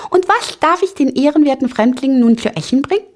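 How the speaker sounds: high, quick squeaky voice; fast, high-pitched polite chirp; little Ping-Pong, eager host. A narrator's reading. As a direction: ask brightly as a tiny, eager host.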